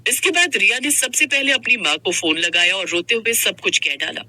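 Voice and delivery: ordinary talk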